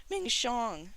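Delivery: high, loud desperate cry; wail. A narrator's reading. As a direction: wail out for a friend. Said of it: "Shi Qingxuan wailed".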